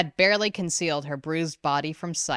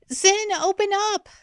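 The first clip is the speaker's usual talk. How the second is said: high, loud desperate sister at the door; screaming; 4 dB louder than usual. A narrator's reading. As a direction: yell through the door. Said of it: "Ren screamed".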